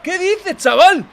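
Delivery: high, loud shocked cry of disbelief; can't believe the result, yelp it out; stunned disbelief. Real, not acted. Streamer reacting to a shock contest result, high shocked yell.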